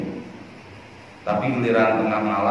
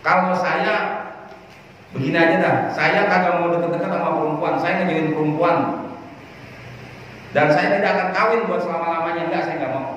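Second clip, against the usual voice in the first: higher-pitched voice of a resolute companion; retold vow; 6 semitones higher than usual. Companion swearing off marriage, retold in a higher, resolute voice. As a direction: declare the retold refusal on a raised pitch. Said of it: "yang lainnya ngomongnya begini".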